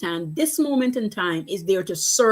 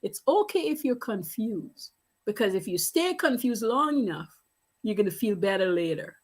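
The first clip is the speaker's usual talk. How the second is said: put-on much softer, soothing little girl's voice; gentle child reassurance; 5 dB quieter than usual. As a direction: comfort mom softly like a wise child. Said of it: "She says".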